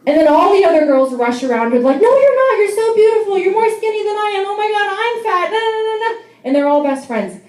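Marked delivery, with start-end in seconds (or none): 2.0-6.1 put-on gushing girly chorus. Women in the restroom reassuring a friend, high gushing. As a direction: gush like anxious best friends. Girls reassuring each other.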